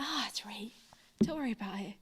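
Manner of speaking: hushed, reassuring aside; whispered reassurance. Retold or imitated. Director whispering to the worried musical director, hushed. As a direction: whisper it to calm her down.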